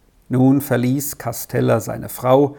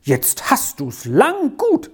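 high, bright schoolboy voice, slowing at the end; thrilled boy telling his friend the news; boy delighted for his friend's invitation; excited boy; 11 semitones higher than usual. A narrator's reading.

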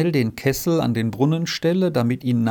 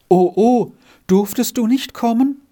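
high, loud plaintive dismay; wailing lament; 9 semitones higher, 6 dB louder than usual. Acted. Margretli learns Renz ran away, wailing in dismay. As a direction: wail it as a worried child would. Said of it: "jammerte Margretli".

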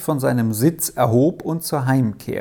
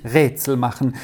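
ordinary delivery

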